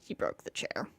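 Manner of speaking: hushed aside; whispering. Real, not acted. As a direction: whisper it to the audience.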